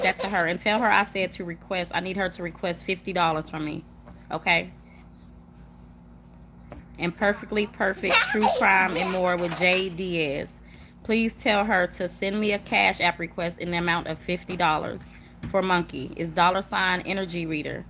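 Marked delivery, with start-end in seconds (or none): none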